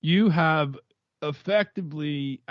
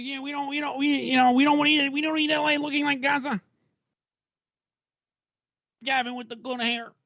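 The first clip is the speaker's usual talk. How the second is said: Rappaport impression; 11 semitones higher than usual. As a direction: mimic a heated pundit rant. Impersonates Rappaport attacking Democrats.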